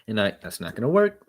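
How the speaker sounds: ordinary delivery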